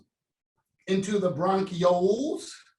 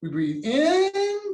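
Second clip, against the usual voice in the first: slow, higher breathe in cue; slow breathing cue; 10 semitones higher, slower than usual. Shown as a demonstration. Teacher leading class breathing, slow and high. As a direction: lift and stretch the inhale. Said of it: "do it with me".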